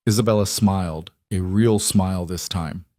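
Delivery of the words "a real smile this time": ordinary delivery, nothing marked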